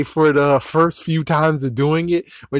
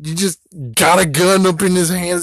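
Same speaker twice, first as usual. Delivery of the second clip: ordinary talk, nothing marked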